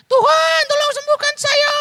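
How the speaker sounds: suara perempuan tinggi memohon dari jauh; suara wanita. Acted. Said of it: "Saya jadi wanita gitu ya". (wanita dari jauh memohon kesembuhan, suara perempuan tinggi)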